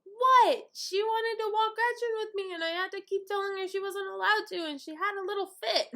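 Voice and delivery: high-pitched, rushed excuse; whiny kid justifying himself; defensive protest. Retold or imitated. Brother explaining himself to parents, defensive protest.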